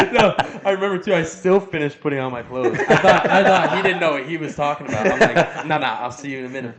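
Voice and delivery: real laughter through the words, high and loud; laughing. Real, not acted. Recruit laughs recalling he thought the captain was wrong. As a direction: laugh at your own mix-up as you tell it. Laughing retelling his blunder.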